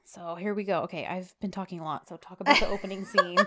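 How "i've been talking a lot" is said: ordinary talk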